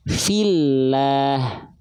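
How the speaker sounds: "Fillah" with a light, thin lam. Shown as a demonstration. Teacher demonstrating thin lam, light and careful. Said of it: "Jadinya tipis".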